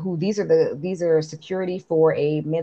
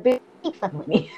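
mock annoyed; 4 dB quieter, faster than usual. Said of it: "he's like".

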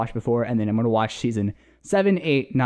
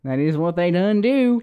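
put-on Southern accent; 4 semitones higher than usual. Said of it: "I can't do the Southern accent anymore".

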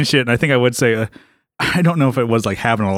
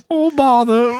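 a higher, prim cartoon Brit sigh; mock cartoon Brit; 8 semitones higher, slower than usual. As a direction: play a fussy cartoon Englishman, lightly. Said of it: "talking like any cartoon character".

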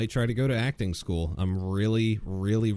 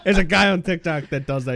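normal talking voice

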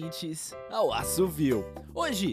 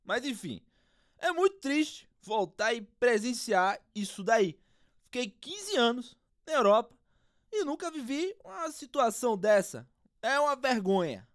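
footballer interview voice; 7 dB louder than usual. Acted. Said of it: "O craque palmeirense".

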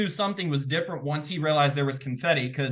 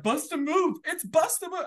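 high, eager answer blurted out; excited blurt; 13 semitones higher than usual. Retold or imitated. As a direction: blurt the answer, pitched well up. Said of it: "I'm like".

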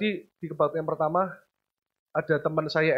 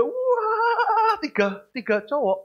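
wah! pitched way up then slow; excited wow; much slower than usual. Acted. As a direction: gush over the three boys. Excited about three sons. (kagum berlebihan, nada tinggi)

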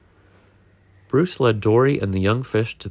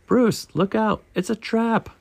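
high, urgent warning, slightly raised volume; alarmed cry; 13 semitones higher than usual. A narrator's reading. Nemo warns his shark friend of danger, crying out. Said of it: "he cried".

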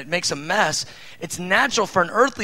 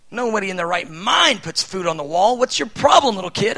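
put-on higher, louder angry scolding of a toddler; harsh scolding parent; 4 semitones higher, 5 dB louder than usual. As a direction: tear into the little kid. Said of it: "Imagine if I had a harsh tone".

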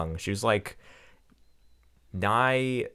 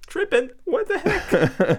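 high, slow squeaky mock disbelief; mock outrage; slower than usual. Retelling the teacher story, high mock outraged voice. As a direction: play it as a scandalized squeak. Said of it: "I'm like".

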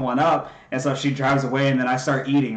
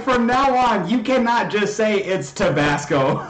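higher and slower, laughter in the words; laughing scold; 5 semitones higher, slower than usual. Retold or imitated. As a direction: lay down the rule while cracking up. Retold scolding, still laughing.

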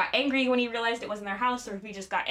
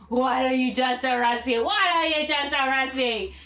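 loud, hammed-up drunken yell, building higher; mock repeated yelling; 4 semitones higher, 6 dB louder than usual. Drunk actor still goading the officer, escalating mock yell. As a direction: imitate a drunk bellowing, louder and higher the second time. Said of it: "Cage just keeps yelling".

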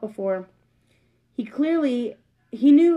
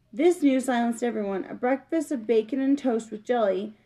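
plain reading voice

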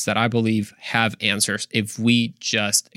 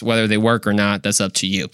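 ordinary talk, nothing marked

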